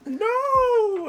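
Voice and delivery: very high, drawn-out wail; mock horrified cry. Connor watches his horse poof, mock horrified cry. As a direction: imitate a friend's despairing no.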